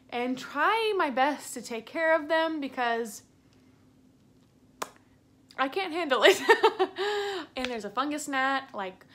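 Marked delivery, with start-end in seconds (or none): 6.4-7.5 short high burst of laughter at herself; real laugh at her own rant. Real, not acted. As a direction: let a laugh spill out.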